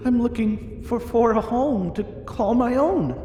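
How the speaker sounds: high, small, halting wolf-pup voice; little wolf character. Acted. Willow answering a huge dragon, high little voice. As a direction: pitch up high, stumbling slightly on the words.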